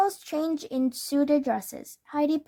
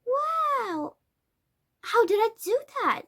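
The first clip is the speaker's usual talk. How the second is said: amazed disbelief; 9 semitones higher than usual. A narrator's reading. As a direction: marvel at your own magic, pitched way up. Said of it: "looked at her wand in disbelief".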